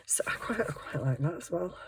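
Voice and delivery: breathy whisper aside. Real, not acted. Showing viewers a page, whispering softly. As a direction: say it under your breath.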